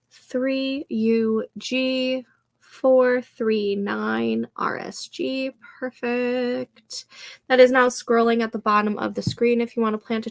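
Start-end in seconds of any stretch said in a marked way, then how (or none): 0.1-5.5 slow, spaced-out letters and digits; slow dictation. Real, not acted. Streamer reading room code to chat, slow and spaced. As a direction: spell out the code slowly for viewers. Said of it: "repeating the room code".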